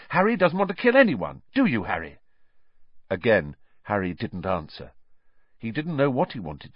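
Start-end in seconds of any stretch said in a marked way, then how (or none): 0.1-2.2 high, louder, flustered; panicky. A narrator's reading. Hermione denying Harry wants to kill, panicky. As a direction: rush it out in a panic. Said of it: "in a panicky voice".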